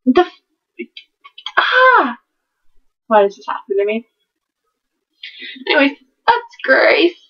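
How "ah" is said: sudden high ah of exasperation; frustrated yelp. Real, not acted. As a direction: cut yourself off with a shriek. Frustrated at bad reviews.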